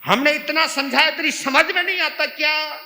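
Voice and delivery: acted exasperated yell, high and loud; angry shouting. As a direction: berate someone at full volume. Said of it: "तभी हम जोर जोर से बोलते हैं".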